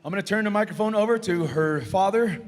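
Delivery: high, carrying announcer call; powwow emcee. Real, not acted. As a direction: announce it to the arena. Emcee introduces giveaway.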